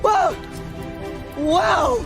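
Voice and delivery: usual speaking voice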